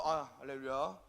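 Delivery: lightly pious pastor greeting; a hint of a reverent hallelujah; holy phone greeting. Shown as a demonstration. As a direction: answer like a devout minister. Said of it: "굉장히 성스럽게 전화를 받아요".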